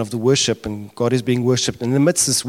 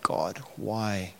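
much softer, hushed retold lament; mock tearful plea; 11 dB quieter than usual. Preacher voicing weeping John pleading with God, tearful and hushed. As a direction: drop to a small, weeping-style plea to God. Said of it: "and he's crying. He's like".

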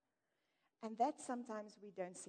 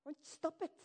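higher, louder scolding voice; mock sing-song scolding; mock judgmental churchgoer; 6 semitones higher, 4 dB louder than usual. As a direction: mimic a preachy scold. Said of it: "we look at people and we say".

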